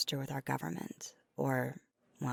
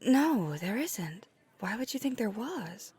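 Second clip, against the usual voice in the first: higher-pitched startled denial; taken aback; 6 semitones higher than usual. Acted. Answering the listener's suspicion of someone else, taken aback. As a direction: deny it in puzzled disbelief.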